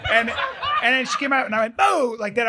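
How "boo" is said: high, sudden "Boo!"; playful scare. Retold or imitated. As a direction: imitate jumping out to startle someone. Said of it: "I was gonna scare her".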